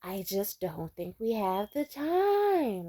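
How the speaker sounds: high, slow, stretched-out words; mock a guy dragging every word out; drawn-out voice. Mocking the date's dad, stretched and slow. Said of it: "he kept extending his voice".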